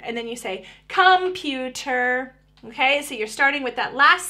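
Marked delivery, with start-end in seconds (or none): 0.9-2.3 "computer" very slow, broken into com-pu-ter; all three syllables. Shown as a demonstration. Parent finishing backwards chaining, "computer" slowly syllable by syllable. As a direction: draw out each syllable of "computer". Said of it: "finally all three syllables".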